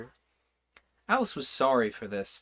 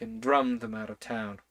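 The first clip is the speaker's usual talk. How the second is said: ordinary delivery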